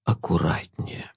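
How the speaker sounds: low, slow soft innocent warning; velvety voice. Acted. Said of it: "бархатным голосом невинно предупредил". (бархатный невинный голос)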